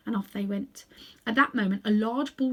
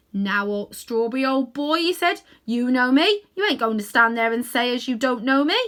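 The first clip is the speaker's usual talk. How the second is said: louder, coaxing Cockney cabbie; 4 semitones higher, 8 dB louder than usual. A narrator's reading. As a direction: coax the old horse like a London cabman. Cabbie coaxing his horse.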